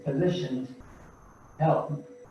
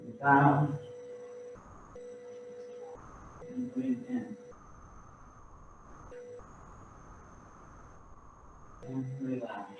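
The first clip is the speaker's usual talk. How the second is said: calm counting of the breaths, gently quieter; guided breathing cues; 8 semitones higher, 12 dB quieter than usual. Acted. Instructor guiding the class's breathing, soft and calm.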